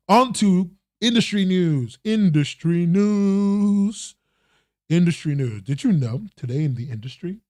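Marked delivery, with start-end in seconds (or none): 0.1-4.1 higher, very drawn-out announcer call; playful slow segment title, held long. Pretend. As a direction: stretch INDUSTRY NEWS out like a jingle. Mock segment jingle announcement.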